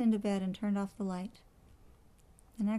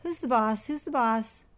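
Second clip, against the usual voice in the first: high, challenging parrot squawk; loud, bossy parrot heckling; parrot yelling; 4 semitones higher, 4 dB louder than usual. A narrator's reading. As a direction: fire the challenge out repeatedly. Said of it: "Penelope yelled".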